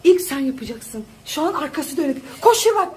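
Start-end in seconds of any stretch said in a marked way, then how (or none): 2.4-2.9 excited cheering. Real, not acted. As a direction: cheer her on as she runs. Cheering child on during chase. (heyecanla bağırarak tezahürat)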